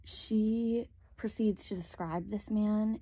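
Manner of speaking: ordinary talk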